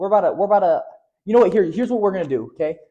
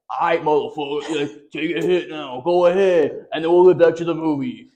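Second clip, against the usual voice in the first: talking cartoon blunt. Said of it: "this little C G I blunt comes on the screen".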